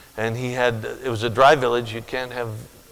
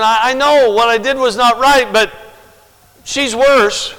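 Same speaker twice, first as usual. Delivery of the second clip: pitched up, louder whiny excuse voice; mocking the drunk woman; 10 semitones higher, 8 dB louder than usual. Preacher mocking the drunk woman's excuse, whiny and high. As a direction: parody her self-justifying whine. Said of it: "She was saying".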